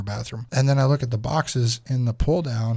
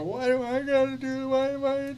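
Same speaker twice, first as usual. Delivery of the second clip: put-on much higher child's voice whining the question; whiny kid voice; 13 semitones higher, 4 dB quieter than usual. Little daughter protesting her punishment, high whiny child voice. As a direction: squeak it up like a sulking little girl. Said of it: "and her go".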